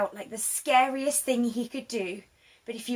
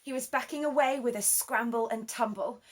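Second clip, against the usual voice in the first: usual speaking voice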